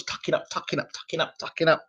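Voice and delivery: soft, rhythmic mutter of tucking up; half-whispered, ticking off the rounds; quiet repeated chant; softly repeating. Retold or imitated. Describing boxer covering on ropes.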